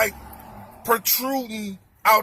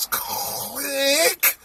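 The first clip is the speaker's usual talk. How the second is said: long stretched-out click; exaggerated, elongated "click" sound effect; mimicked lighter click; 3 semitones higher than usual. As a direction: draw the word out like a slow lighter flick. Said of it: "It was the loudest click".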